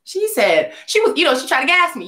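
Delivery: ordinary talk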